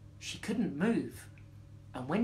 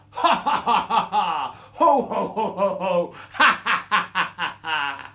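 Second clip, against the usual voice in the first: loud, booming stagy belly laugh; roaring laughter; 9 dB louder than usual. A narrator's reading. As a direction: roar with mocking laughter in bursts. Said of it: "this time with laughter".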